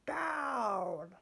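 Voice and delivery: a witch's sharp call from the sky; witch crying out. Acted. Witch ordering her broom down, high cry. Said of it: "cried the witch".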